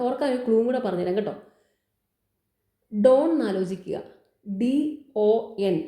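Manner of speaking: ordinary talk, nothing marked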